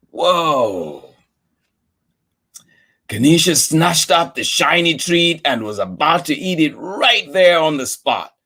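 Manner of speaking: ordinary delivery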